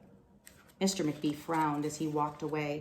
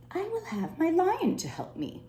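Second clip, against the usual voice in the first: hopeful thought; 13 semitones higher, 3 dB louder than usual. Acted. Librarian thinks hopefully.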